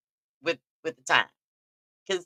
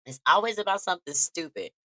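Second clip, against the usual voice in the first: normal talking voice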